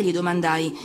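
ordinary delivery

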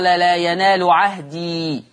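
slow "لا ينال عهدي" with the ya held on the stop; recitation stopping. Shown as a demonstration. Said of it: "حال الوقف". (تلاوة مع الوقف على "عهدي" بإثبات الياء)

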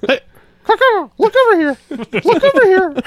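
high, loud bird-call voice; caw, then call them over high and loud; chirping bird person. Retold or imitated. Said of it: "me and my wife do that all the time".

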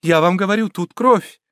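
high, urgent alarm; insist, voice climbing; alarmed cry; frightened insistence. A narrator's reading. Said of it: "жестом испуга указывала".

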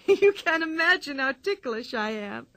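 high voice bubbling with laughter; Pippi, ticklish giggling. A narrator's reading. Pippi telling the bull she's ticklish, giggling. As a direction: talk through helpless giggles.

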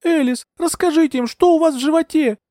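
high-pitched, relentless appeal; insistent. A narrator's reading. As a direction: keep pressing her, high and urgent. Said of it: "не унималась я".

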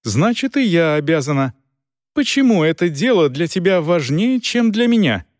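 a hint of a defiant young woman; lightly voiced woman. A narrator's reading. Dors argues, rising.

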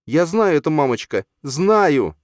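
put-on high, loud pleading boy; boy telling mum he knows; insistent child voice. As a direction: insist like a scared small boy. Frightened boy insists to mother.

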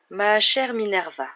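slow, drawn-out musing address; songeur. A narrator's reading. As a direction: stretch it out, lost in thought. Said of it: "d'un air songeur". (ton songeur, mots étirés)

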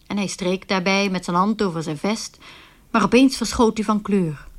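usual speaking voice

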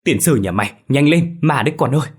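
a loud, fearful shout to hurry; panicked urging. Acted. Father fleeing ghosts, urging his son loudly. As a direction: yell at the boy to run. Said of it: "và giục thằng Quang".

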